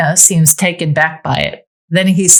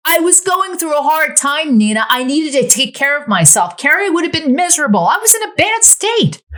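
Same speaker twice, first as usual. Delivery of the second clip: higher pleading character voice; defensive ex-wife; 12 semitones higher than usual. Acted. Amanda explains her hard time to Nina, higher and pleading. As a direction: justify yourself, voice climbing.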